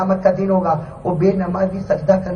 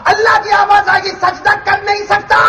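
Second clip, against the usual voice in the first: high-pitched cry of helplessness; impassioned preaching; 12 semitones higher than usual. Acted. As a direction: act out the sinner who cannot bow, voice held high. Voices sinner unable to bow. (اونچی آواز میں بے بسی کی پکار)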